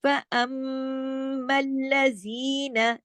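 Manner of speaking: फअम्मल्लज़ीना with a long hummed mm; sustained monotone Quran-lesson recitation; full ghunnah. Shown as a demonstration. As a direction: recite very slowly, holding mm and ज़ी in फअम्मल्लज़ीना. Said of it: "जी दो हरकत खींचा".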